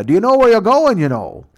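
high, loud Canadian Mountie voice; mock Mountie. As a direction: ham up a chirpy Canadian cop.

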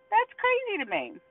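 high-pitched amazement; incredulous. Real, not acted. Preacher baffled by leaders worried about a mat, incredulous. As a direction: start way up high in disbelief. Disbelief at worry over the mat.

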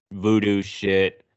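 normal talking voice

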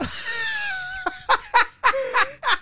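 a burst of teary laughter; laughing sob. Real, not acted. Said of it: "se puso a llorar".

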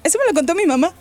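ordinary talk, nothing marked

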